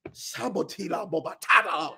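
low, soft tongues under the breath; speaking in tongues. Real, not acted. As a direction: pray in tongues, quietly.

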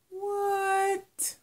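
very high, drawn-out mock-baffled squeak; mock disbelief. Student told by therapist she can't always be on, comically baffled. Acted reaction to therapist's warning.

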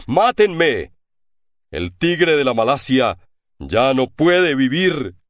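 a prisoner shouting in his chains; shouting in despair. A narrator's reading. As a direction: scream for death, then sink. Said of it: "sacudiendo con fuerza las cadenas y gritando".

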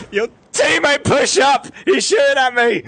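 shouting. Real, not acted. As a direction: yell the callout in a panic. Under fire, calling out.

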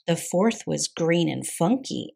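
ordinary delivery

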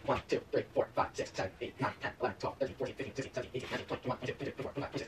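half-whispered count, straining through the plank; strained counting under breath. Real, not acted. Counting while holding a plank.

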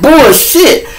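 high incredulous "Are you serious?"; indignant disbelief. Retold or imitated. Confronting his partner about the money, high incredulous. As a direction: challenge him, pitch up high. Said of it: "I'm like".

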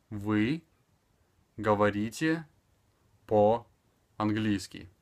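"Вы говорите по-английски" word by word, long pauses between; slow, evenly spaced teaching delivery; extremely slow. Shown as a demonstration. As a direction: separate and stretch each word for a learner. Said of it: "speaking extremely slower".

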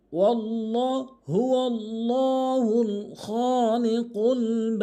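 slow, drawn-out melodic chanting; Quran recitation. Shown as a demonstration. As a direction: recite the verse in slow tajwid.

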